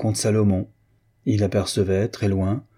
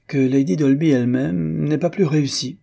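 raised, louder playful jab; teasing; 7 semitones higher, 3 dB louder than usual. A narrator's reading. Christiane teasing her husband about Morière, mock-admiring. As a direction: poke fun at the name-dropper lightly. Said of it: "blaguant ainsi Morière".

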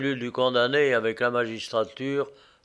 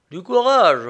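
scornful sneer, louder and higher; 6 semitones higher, 8 dB louder than usual. A narrator's reading. Condemned man mocking the prosecutor, sneering. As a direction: throw the word back with a mocking laugh in it. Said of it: "ricana-t-il".

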